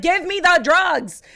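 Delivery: loud, high, dramatized pleading; desperate demand. Retold or imitated. Labouring mother demanding drugs from nurse, desperate. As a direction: demand it at the end of your rope.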